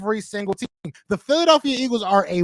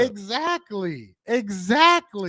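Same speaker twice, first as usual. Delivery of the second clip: drawn-out exactly; much slower than usual. Real, not acted. Emphatic agreement in debate.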